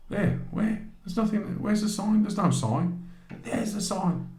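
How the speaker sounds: high, exasperated back-and-forth; bewildered arguing, voice up high; incredulous. Retold or imitated. Back-and-forth over the invisible sign.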